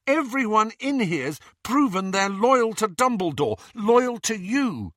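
very high, heated protest; impassioned argument. Acted. Arguing with friends who won't trust them, impassioned. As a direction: pour out a high-pitched, indignant appeal. Quarrel over being trusted.

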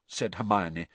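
ordinary delivery, nothing marked

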